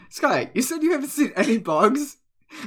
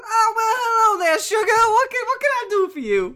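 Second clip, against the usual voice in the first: shopkeeper woman voice; 11 semitones higher than usual. Acted. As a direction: greet them sweet and high, like a Southern shopkeeper. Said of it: "She goes".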